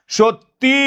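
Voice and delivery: high, lengthened সত্যি; stressed final vowel. Shown as a demonstration. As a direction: stretch the ending of সত্যি to show its ই. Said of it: "এই রস্যইটা তয়ে জফলার পরে".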